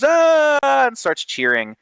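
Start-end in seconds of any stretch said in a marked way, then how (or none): 0.0-0.6 high, loud tavern cheer; rowdy "aah!" cheer; crowd cheer. Acted. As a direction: roar like a whole bar cheering. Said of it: "the whole bar just goes".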